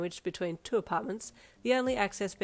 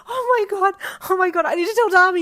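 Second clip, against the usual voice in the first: much higher and louder, overjoyed; ecstatic cry; 13 semitones higher, 15 dB louder than usual. Acted. Teen overjoyed after acceptance, crying out high and loud. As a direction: burst out in happy tears. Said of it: "you cried".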